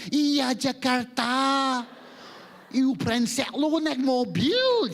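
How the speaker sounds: high, drawn-out mock Indonesian accent. Malaysian adapting to an Indonesian from Jakarta, high exaggerated Indonesian accent. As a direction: stretch it into a sing-out Indonesian caricature. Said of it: "we meet an Indonesian person".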